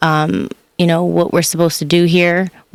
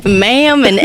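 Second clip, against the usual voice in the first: higher Southern accent, laughing; 5 semitones higher, 4 dB louder than usual. Shown as a demonstration. Running it back for the host, Southern accent. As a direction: replay the Southern charm, breaking into laughter. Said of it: "can you run that back".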